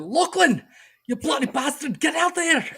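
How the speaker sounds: high-pitched Scottish yell at the dog; Scottish accent shout. Acted. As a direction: holler in a hammed-up Scottish accent. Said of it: "shout at him with a Scottish accent".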